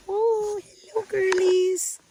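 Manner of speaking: high, slow sing-song coo to the pets. Real, not acted. Greeting her pets.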